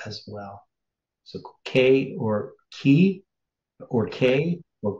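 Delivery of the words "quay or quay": K as "kay", then key as "kee"; variant pronunciations. Shown as a demonstration. Listing variants for a study partner, K then key, said slowly. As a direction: set K against key, slowly. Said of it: "it's sometimes pronounced that".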